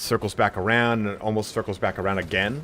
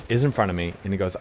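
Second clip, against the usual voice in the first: ordinary delivery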